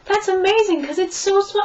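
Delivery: high, delighted disbelief; retold amazement. Retelling her reaction to the interviewer, high amazed gush. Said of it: "I was like".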